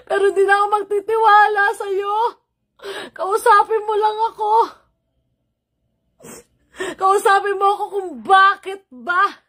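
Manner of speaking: high, tearful pleading; begging through sobs; crying. Real, not acted. Pleading with sister through tears.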